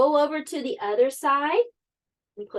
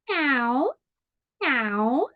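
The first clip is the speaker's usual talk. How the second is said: meows sweeping up at the end; cat meowing. Acted. Cat calls to the spider, rising meows. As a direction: meow like a questioning cat. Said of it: "cried the cat".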